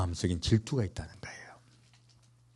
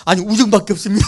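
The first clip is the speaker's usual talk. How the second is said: laughing Peter; 9 semitones higher, 6 dB louder, faster than usual. Retold or imitated. Peter answering Jesus, laughing.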